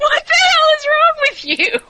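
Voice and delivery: high, loud, indignant half-laughing yell; outraged yell. Retold or imitated. Yelling at the box from the former boss, high and outraged. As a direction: shout at the absent boss in disbelief. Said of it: "actually saying out loud".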